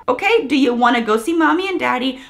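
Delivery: normal talking voice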